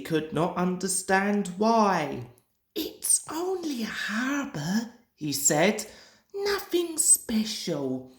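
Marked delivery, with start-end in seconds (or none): 2.8-4.9 lightly higher, softer engine voice; a hint of a young grumbling engine; Percy's voice. A narrator's reading. Percy shrugging off the harbour, lightly voiced. 6.3-8.1 lightly higher, softer engine voice; a hint of a young grumbling engine; Percy's voice. A narrator's reading. Percy shrugging off the harbour, lightly voiced.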